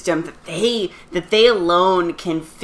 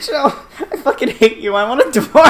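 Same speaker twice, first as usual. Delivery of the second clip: higher, laughing mock outrage; laughing complaint; 6 semitones higher than usual. Real, not acted. Wife to husband after his bad pun, laughing outrage. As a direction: protest a terrible pun through laughter.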